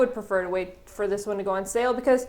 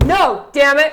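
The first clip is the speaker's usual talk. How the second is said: mock shout; 5 semitones higher, 10 dB louder, slower than usual. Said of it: "I'll be like".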